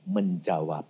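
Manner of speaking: ordinary delivery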